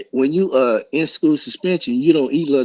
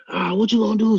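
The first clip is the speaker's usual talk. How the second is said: high, cocky taunt; taunting challenge; 10 semitones higher than usual. Retold or imitated. Rival taunting him before class, cocky high dare.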